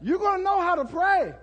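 preacher hammering the point; emphatic preaching. Shown as a demonstration. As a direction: drive it home to the congregation. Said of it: "repeated with rising force".

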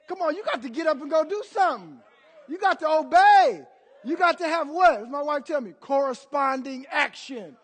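loud, high preacher's proclamation; ringing, emphatic sermon delivery; full-force preaching. Real, not acted. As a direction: drive it out to the whole congregation.

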